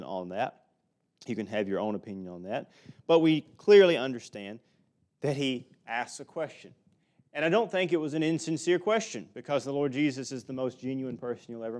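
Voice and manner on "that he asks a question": ordinary talk, nothing marked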